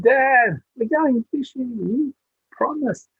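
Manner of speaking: put-on very high, loud, slow child's cry; thrilled little boy. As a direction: squeal it like a kid who can't wait. Boy wakes dad, thrilled.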